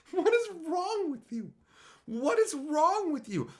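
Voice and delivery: high, loud disbelief; exasperated outburst. Real, not acted. Ranting at chat about people who eat ghost peppers, high exasperated. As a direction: throw up your hands in disbelief.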